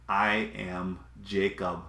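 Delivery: quiet, measured quote of a man admitting his name; hushed admission. Retold or imitated. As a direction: let each word land softly and slowly. Jacob's confession, dramatic pause.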